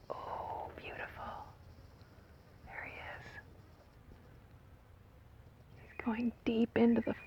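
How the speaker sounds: barely audible, whispered marvelling; awed whisper. Real, not acted. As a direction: whisper it to yourself as you watch. Watching the bee enter a flower.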